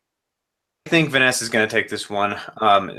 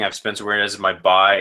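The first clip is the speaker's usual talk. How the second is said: ordinary talk, nothing marked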